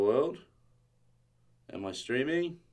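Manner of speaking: ordinary talk, nothing marked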